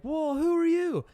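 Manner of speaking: high, slow, challenging question; mock confrontational stranger; mock beggar. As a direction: imitate the stranger sizing him up. Mimics beggar questioning dad.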